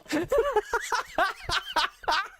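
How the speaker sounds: high, breathy, trailing laugh; high wheezing laugh. Real, not acted. Streamer cracking up at the Reddit post, wheezing laugh. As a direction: laugh until it fades out.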